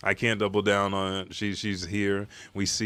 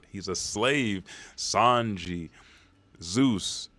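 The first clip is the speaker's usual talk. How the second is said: ordinary talk, nothing marked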